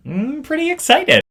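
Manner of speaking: squealing high with real excitement; excited. Real, not acted. Vlogger gushing about tarot cards, thrilled squeal. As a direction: burst out thrilled and loud.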